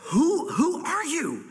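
high, slow, astonished question; awed wonder. Acted. Preacher meditating on who Jesus is, awed. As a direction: marvel slowly, voice lifted high.

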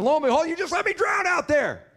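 drowned man wailing at God; upset complaint to God. Retold or imitated. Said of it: "and he's upset".

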